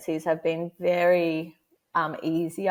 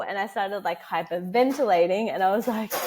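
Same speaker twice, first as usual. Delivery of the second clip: usual speaking voice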